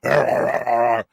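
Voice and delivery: rough growling mock Batman rasp; mock Bale growl. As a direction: growl like an over-the-top Batman.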